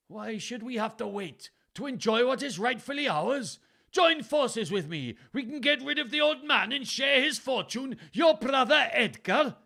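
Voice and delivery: very high, loud Welsh, building; Welsh accent. A narrator's reading. Father reads son's supposed plot aloud, building Welsh accent. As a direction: read the letter out with rising outrage in stage Welsh. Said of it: "Gloucester read aloud".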